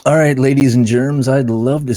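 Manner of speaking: loud, high showman sign-off; announcer sign-off. Real, not acted. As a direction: boom it out like a host. Signing off the meeting.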